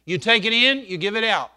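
loud, high preacher's call; preaching shout. Real, not acted. Preacher shouting the lesson to the congregation, loud and high. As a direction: belt it out to the whole church.

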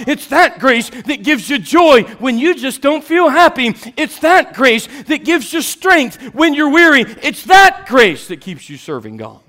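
high, loud preaching cry; preaching at full force. Acted. Preacher proclaiming God's grace to the congregation, loud and high. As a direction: pour it out from the pulpit.

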